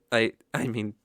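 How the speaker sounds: usual speaking voice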